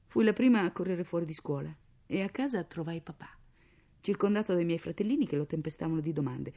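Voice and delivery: plain reading voice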